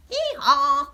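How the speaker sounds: loud hee-haw bray; donkey bray. Acted. Reading a baby book to children, donkey bray. Baby says animal sounds.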